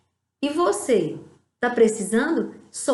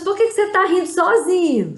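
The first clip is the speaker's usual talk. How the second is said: higher, louder puzzled busybody voice; nosy onlooker; 8 semitones higher, 6 dB louder than usual. Pretend. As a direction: ask it like a bemused coworker. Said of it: "aí a pessoa diz". (voz de colega curioso, mais aguda e alta)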